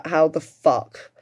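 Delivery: ordinary talk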